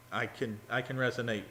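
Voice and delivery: ordinary talk, nothing marked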